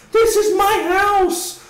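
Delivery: high, slow mock shriek of outrage; outraged shriek. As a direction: squeal it like she's outraged at guests. Acting the outraged wife.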